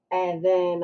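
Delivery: normal talking voice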